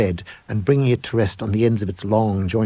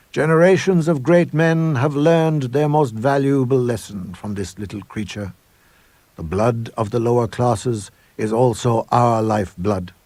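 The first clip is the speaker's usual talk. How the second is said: grand declaration; 5 semitones higher than usual. Acted. Headmaster proclaiming the ritual to the assembled boys, solemn and grand. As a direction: declare it like a high priest at a ritual.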